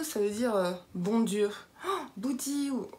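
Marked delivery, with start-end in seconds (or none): 1.9-2.9 slow, drawn-out mock exclamation; mock dismay. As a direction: play a mild "good Lord!", higher and stretched.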